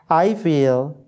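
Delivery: ordinary talk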